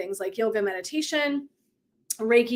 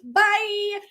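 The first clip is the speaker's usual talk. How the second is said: cheeky; 9 semitones higher than usual. Retold or imitated. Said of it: "or like".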